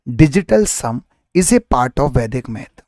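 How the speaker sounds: usual speaking voice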